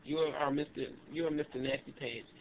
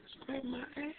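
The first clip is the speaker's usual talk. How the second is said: Home Alone shock; 10 semitones higher, 9 dB quieter than usual. Retold or imitated. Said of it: "I'm like Home Alone".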